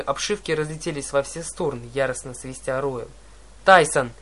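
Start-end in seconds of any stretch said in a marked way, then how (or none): 3.6-4.1 loud, desperate yell of a name. Acted. Hero screaming for Tyson as the ship explodes, loud yell. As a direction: scream his name from the air. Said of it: "завопил я".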